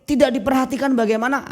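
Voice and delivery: high, quick incredulous protest; indignant husband. Retold or imitated. Husband protests to his wife that he does care, indignant. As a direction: fire back in disbelief. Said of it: "Si pria berpikir".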